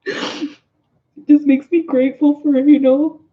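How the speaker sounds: real tearful gratitude, breaking off between phrases; choked up, halting over long pauses; deeply moved. Real, not acted. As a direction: speak from the heart while trying not to cry. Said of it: "that just hit me in my heart".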